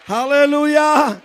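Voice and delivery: slow ringing shout of hallelujah; drawn-out hallelujah. Real, not acted. Preacher rousing the congregation, slow drawn shout. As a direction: stretch it out and shout it.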